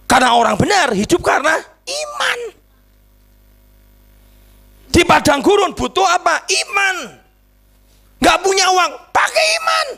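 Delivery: high, shouted preacher's exhortation; full-force preaching. Real, not acted. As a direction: rouse the congregation, peaking very high.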